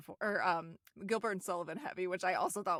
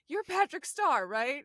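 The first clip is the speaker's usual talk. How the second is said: pitched up and louder; high loud mock question; 6 semitones higher, 9 dB louder, slower than usual. Retelling SpongeBob, the villain pushes the wallet high and loud. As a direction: squeak it out as an eager villain. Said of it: "And he goes".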